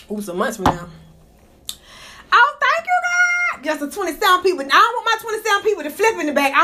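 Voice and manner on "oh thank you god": high, joyful squeal to God; squealing thanks. Real, not acted. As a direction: shriek your thanks upward.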